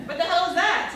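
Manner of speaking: high, loud disbelief; incredulous Larry. Acted. Larry David scoffing at an unknown movie, incredulous. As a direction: scoff at the title.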